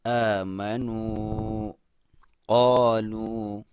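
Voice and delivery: slow, drawn-out tajweed chant; slow Quran recitation. Shown as a demonstration. Teacher reciting the verse for students, slow and drawn out. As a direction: recite it slowly, stretching each vowel. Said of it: "क़ाफ को मोटा".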